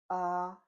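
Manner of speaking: AH held high and level; first tone. Shown as a demonstration. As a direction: Mandarin first tone on AH, high, steady and loud. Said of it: "The first tone is this".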